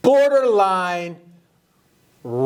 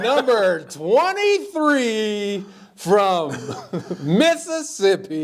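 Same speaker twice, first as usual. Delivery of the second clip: slow, stretched player introduction; arena announcer; much slower than usual. Pretend. Announcer introducing number twenty-three, laughing mock grandeur. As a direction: draw the words out grandly through laughter.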